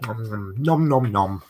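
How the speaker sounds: P=A; nom nom chomping. Pretend. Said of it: "But now I'm not pretending".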